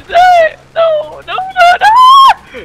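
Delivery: high, loud panicked shriek; screaming. Real, not acted. Gamer screaming as he goes down in game. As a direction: shriek it, rising to full volume.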